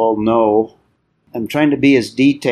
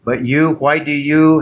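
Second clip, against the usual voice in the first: "you" pushed higher and louder each time; stress on you; 4 semitones higher, 4 dB louder than usual. Shown as a demonstration. Teacher reading Paul's challenge, stressing "you". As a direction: hit the emphatic "you" squarely. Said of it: "The you ... it's emphatic".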